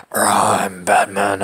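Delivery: slow, low growl; gargling-glass superhero voice; Batman. Acted. Showing how the superhero talks, Batman voice. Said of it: "How do you do the Batman voice?".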